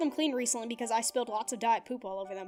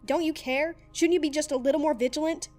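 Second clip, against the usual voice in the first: loud, raised, furious tirade; furious shouting; 3 semitones higher, 7 dB louder than usual. Acted. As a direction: hammer him with every accusation. Dib furiously confronts Zim.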